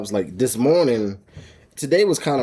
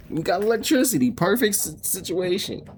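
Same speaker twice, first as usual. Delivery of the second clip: excited; 7 semitones higher than usual. Real, not acted. Excited about living on land.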